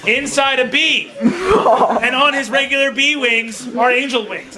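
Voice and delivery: ordinary delivery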